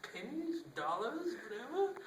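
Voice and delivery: very high, squeaky little items; high squeaky list; squeaky mock voice. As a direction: squeak each word like a toy. Mimics a monkey grinder, very high.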